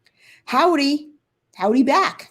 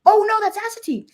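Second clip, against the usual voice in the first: surprised; 11 semitones higher, 5 dB louder, faster than usual. Real, not acted. Realizes she named the wrong place.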